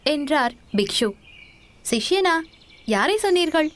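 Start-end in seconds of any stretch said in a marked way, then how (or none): 1.9-3.7 sudden high startled question; taken aback, pitch jumps up; surprised disbelief. Acted. Young man hears he is the monk's disciple, startled.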